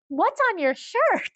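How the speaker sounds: high, slow, amazed question; curious surprise. Retold or imitated. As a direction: ask like you just spotted something fascinating. Stranger surprised by his shirt.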